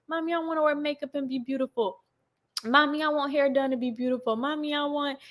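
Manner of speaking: lightly voiced pleading little girl; imitated little daughter. Daughter asking mom to make her beautiful, lightly imitated child. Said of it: "Journey started to get it twisted".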